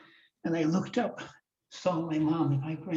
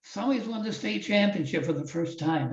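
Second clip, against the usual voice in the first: imitating the announcer; 6 semitones higher than usual. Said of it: "he says".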